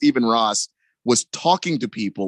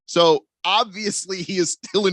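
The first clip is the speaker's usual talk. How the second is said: usual speaking voice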